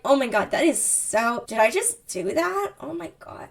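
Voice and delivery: hammed-up mortification, rising then fading; play-acted embarrassed self-talk; acted embarrassment. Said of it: "Her embarrassment cuts through this".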